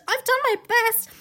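put-on high, eager boy voice; nervous boy making excuses; anxious pleading Edmund. A narrator's reading. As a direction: plead your case, pitched well up.

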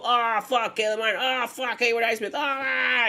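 high, loud hyped-up outburst; imitated over-the-top game-day hollering; mock excited shouting. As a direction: yell the names like a worked-up fan. Mimicking excited shouts of players' names.

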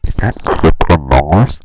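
sudden very loud yell; startled shout. Real, not acted. Host yelling at something surprising mid-recording, loud. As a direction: blurt it out in alarm.

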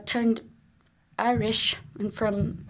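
usual speaking voice

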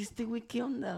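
stunned disbelief. Retold or imitated. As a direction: pitch jumps up in shocked confusion. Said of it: "yo me quedé así de que". (incredulidad atónita, voz más aguda)

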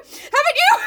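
very high, loud questioning shriek; mock panic. Beth pressing Jo about scarlet fever, mock panic. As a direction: shoot the question up into a hammed-up squeal.

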